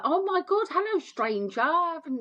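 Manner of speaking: high delighted hello; excited greeting. Retold or imitated. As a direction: greet an old friend you've missed. Long-lost friend answers phone.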